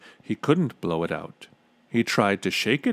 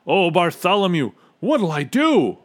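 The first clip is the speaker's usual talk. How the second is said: loud, high despairing wail; high-pitched distressed cry; 8 semitones higher, 7 dB louder than usual. A narrator's reading. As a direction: wail for help. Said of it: "the trumpeter wailed".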